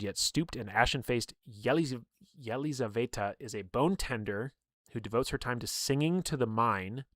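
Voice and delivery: ordinary talk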